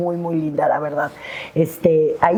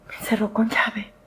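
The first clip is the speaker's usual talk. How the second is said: susurro apenado; confesión en susurro; 4 semitones higher, 6 dB quieter than usual. Retold or imitated. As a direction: lo admite en voz baja. Confiesa la razón del retraso. (susurro avergonzado)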